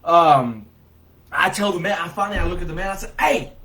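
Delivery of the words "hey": sharp, high, loud "hey"; retold shouted call. Bystander confronting a man by the pool, loud high call. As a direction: call out to stop him in his tracks.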